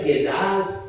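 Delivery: usual speaking voice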